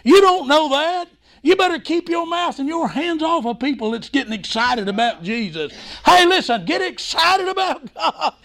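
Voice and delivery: high-pitched, fervent sermon; excited preaching. Real, not acted. Preacher telling the congregation to get excited about God, fervent.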